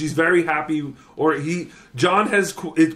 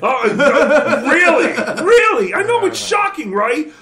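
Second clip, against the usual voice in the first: mock shock; 11 semitones higher, 6 dB louder, slower than usual.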